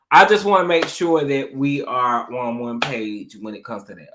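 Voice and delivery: ordinary delivery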